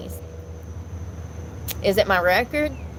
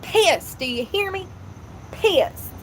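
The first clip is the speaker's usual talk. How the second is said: high, exasperated pissed; fed up and pissed off over a wet bag; real pissed off; 7 semitones higher, slower than usual. Real, not acted. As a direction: vent the anger, pitch up. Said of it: "I'm so mad".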